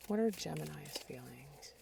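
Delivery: soft unvoiced whisper to the cards; hushed whisper asking the cards; whispered question. Real, not acted. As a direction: breathe the question quietly, as if to spirit. Asking spirit during tarot pull.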